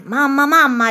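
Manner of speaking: excited calling. A narrator's reading. Young king running to his mother, excited calling. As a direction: burst out calling with eager urgency.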